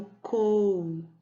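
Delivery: "cou" drawn out long; stressed syllable. Shown as a demonstration. Teacher answering which syllable is strongest, "cou" stretched out. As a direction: hold the stressed "cou" slowly. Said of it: "Qual é a sílaba mais forte?".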